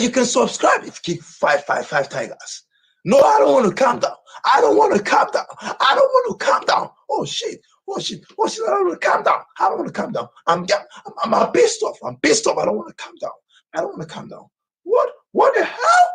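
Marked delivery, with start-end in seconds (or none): 3.0-6.9 high, cracking shouts of refusal; refusing to calm down, at full shout; angry yelling. Real, not acted. 7.1-14.5 strained angry rant. Real, not acted. Raging refusal continues. 14.8-16.1 high, slow, cracking shout; a furious, disbelieving cry; angry cry. Real, not acted. Raging refusal continues.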